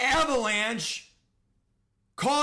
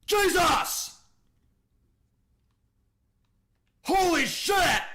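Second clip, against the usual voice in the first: furious yelling; 4 semitones higher, 4 dB louder than usual. Real, not acted. Fumble in a video game.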